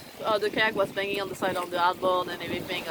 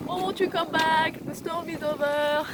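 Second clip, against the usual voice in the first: much higher, slow plaintive put-on voice; voicing Tipsy; 7 semitones higher than usual. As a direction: play Tipsy calling from the boat. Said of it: "She was like".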